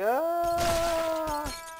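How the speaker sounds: long high scream held back in volume; mock scream. Gamer mimics the game's screaming, high mock scream. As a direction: draw out a high imitation scream. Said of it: "stop screaming like that? It's like".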